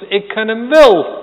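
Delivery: loud high cry, WEL falling hard; fleeing disciples calling out loudly. Acted. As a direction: shout it while running away. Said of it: "die roepen het uit in hun vlucht".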